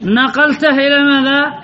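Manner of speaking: ordinary talk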